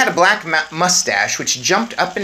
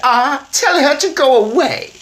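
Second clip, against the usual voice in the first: haughty Mrs Jenkins; 13 semitones higher, 9 dB louder than usual. Acted. Snobbish mother dismisses the old woman, high and loud. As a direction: look down your nose at her. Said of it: "Miss Jenkins said".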